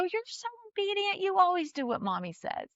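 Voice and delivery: put-on mother beams praise at her toddler son, smiling. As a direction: say it through a real smile, delighted. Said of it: "So I'm smiling, a real smile now".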